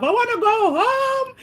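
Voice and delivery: high crying wail of fear; sobbing to go home, shrieked high; crying scream. Retold or imitated. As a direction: wail it like a terrified child. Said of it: "I was screaming my head off".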